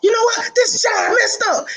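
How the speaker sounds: mock-angry coach holler; clean coach outburst. Shown as a demonstration. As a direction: shout it like a coach biting back a curse. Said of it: "say other words instead of the cuss word".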